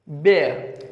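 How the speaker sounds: ordinary talk, nothing marked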